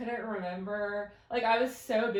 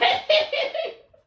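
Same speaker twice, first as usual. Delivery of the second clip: high burst of laughter; big loud laugh, high; 12 dB louder than usual. Real, not acted. Laughs at her own story.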